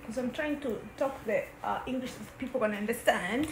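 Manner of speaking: mock British accent, lightly done; a kid faking a British accent; put-on British accent. Sister teased for her mock British accent. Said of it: "that's not how you talk English".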